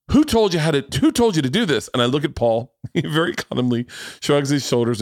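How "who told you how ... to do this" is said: high-pitched, indignant demand; mock scolding. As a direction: imitate an alarmed medic telling someone off. Retold medic scolds him for self-prescribing.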